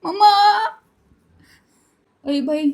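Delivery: usual speaking voice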